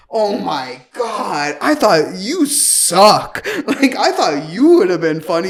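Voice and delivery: loud, drawn-out mock yelling; retold screaming. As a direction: imitate an obnoxious date yelling insults, dragging the words. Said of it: "screaming it too".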